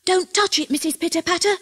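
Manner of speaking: high, urgent warning; alarmed cry. A narrator's reading. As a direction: cry out high to stop her. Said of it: "cried Davy".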